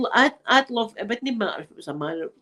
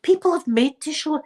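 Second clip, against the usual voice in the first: usual speaking voice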